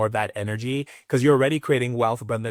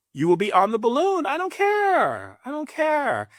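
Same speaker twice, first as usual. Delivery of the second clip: squeaky dismissive; 13 semitones higher than usual. Acted. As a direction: sing it off in a falsetto. High dismissive exaggeration.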